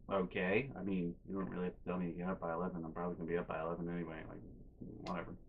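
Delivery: fast, low, very soft grumble; meek muttering. Retold or imitated. Kid answering yelling mom, quiet rapid mumbling. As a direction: trail away under your breath, rushing it.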